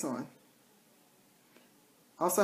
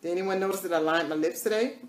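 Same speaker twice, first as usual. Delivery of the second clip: ordinary delivery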